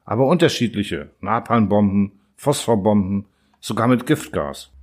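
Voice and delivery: ordinary delivery, nothing marked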